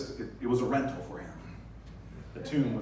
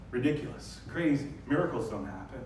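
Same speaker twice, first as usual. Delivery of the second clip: ordinary talk, nothing marked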